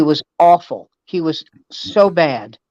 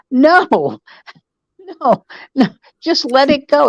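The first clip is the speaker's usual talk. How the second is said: high-pitched, imploring refusal; exasperated high-voiced retold plea; pleading protest; 8 semitones higher than usual. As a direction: plead with a hothead to drop it. Retold pleading with angry friend.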